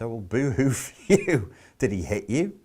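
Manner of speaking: dismissive, mocking boo-hoo; mock sympathy. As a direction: brush off the complaint with sarcastic pity. Mocks a victim's complaint.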